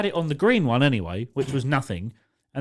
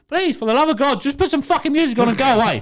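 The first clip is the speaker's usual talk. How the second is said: higher, louder, fast fed-up plea; high exasperated retold rant; 12 semitones higher, 5 dB louder, faster than usual. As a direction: beg her to go away, fed up.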